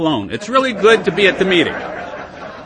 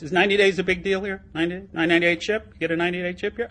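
ordinary talk

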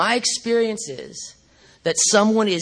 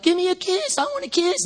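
put-on high-pitched, childlike pleading; mock pleading for a kiss, pitched way up; playfully needy high voice; begging old man; 11 semitones higher than usual. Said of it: "my old man was like".

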